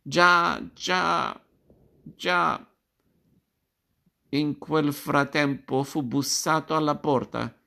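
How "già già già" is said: a braying donkey sound stretched over già. A narrator's reading. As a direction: bray già like an ass in chorus. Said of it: "ragliando sonoramente".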